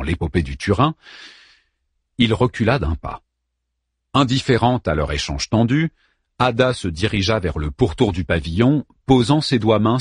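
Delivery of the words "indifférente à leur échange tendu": plain reading voice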